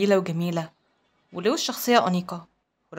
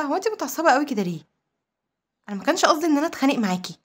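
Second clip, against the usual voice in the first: higher-pitched, mildly bewildered protest; taken aback; 5 semitones higher than usual. A narrator's reading. Garcia asks Monica why she is so angry, surprised. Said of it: "استغرب وقال لها".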